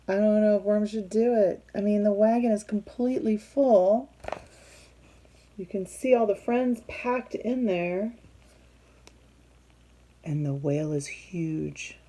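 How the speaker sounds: ordinary delivery, nothing marked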